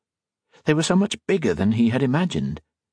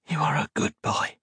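soft unvoiced whisper; 7 semitones higher, 5 dB quieter than usual. A narrator's reading. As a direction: breathe it to him like a secret blessing. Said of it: "she whispered".

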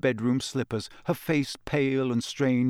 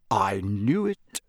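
low, slow, weary voice; heavy; 4 semitones lower, slower than usual. A narrator's reading. Father reading bad news, heavy and slow. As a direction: let it land heavily. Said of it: "said Mister Weasley heavily".

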